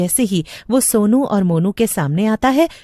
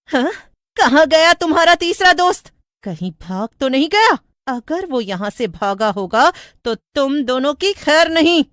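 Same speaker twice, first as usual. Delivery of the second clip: high, sharp furious witch; angry witch; 8 semitones higher than usual. A narrator's reading. Witch angrily asking where their third friend went. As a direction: demand answers, threatening. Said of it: "गुस्से में कहती है".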